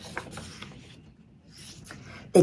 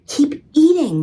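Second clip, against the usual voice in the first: higher, louder scolding order; 9 semitones higher, 4 dB louder than usual. A narrator's reading. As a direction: push them on, bossy and urgent. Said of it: "Maria scolded".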